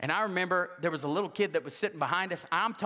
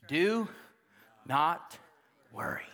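Do, not, worry each set apart, fading down; slow emphatic; 3 semitones lower, much slower than usual. Shown as a demonstration. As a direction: space it out, one word at a time. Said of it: "slow emphatic command".

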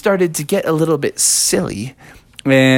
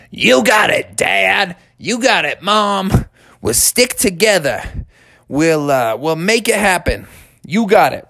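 put-on higher, bouncy kids' chorus of agreement; lightly voiced kids promising to stick together; eager kids; 6 semitones higher than usual. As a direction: answer Dad like keen little cubs. Kids answering Dad together.

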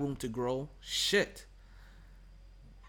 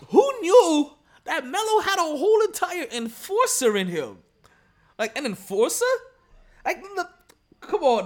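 exaggerated amazement; 12 semitones higher, 9 dB louder than usual. Real, not acted. Host marvels at Melo turning enforcer, loudly incredulous. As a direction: stretch it out in loud, incredulous wonder. Mock amazement at player's new role.

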